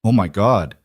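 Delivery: ordinary delivery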